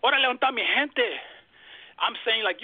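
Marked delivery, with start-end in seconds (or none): 0.1-1.2 Mexican Spanish call. Retold or imitated. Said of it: "If I was to say".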